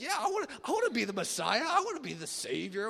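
high, loud, hurried enthusiasm; eager wannabe. Acted. Disciple declaring he wants to be Messiah, eager. Acting out an eager would-be savior.

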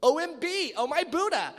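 loud, high mock shock; hammed-up oh my Buddha; mock exclamation. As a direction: exclaim it like juicy news. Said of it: "you're like".

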